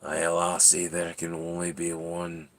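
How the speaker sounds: low, soft Scottish menace; hushed, low mock threat with a Scottish accent; glaring Scottish cat. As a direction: warn quietly in a Scottish brogue. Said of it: "he just glares".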